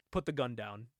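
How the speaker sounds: low, soft, controlled order; quiet retold command. Father facing his daughter's gun, quiet command. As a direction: keep the order down low and restrained.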